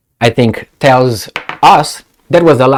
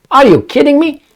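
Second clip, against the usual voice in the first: incredulous; 12 semitones higher than usual. Real, not acted. Disbelief at missile offer.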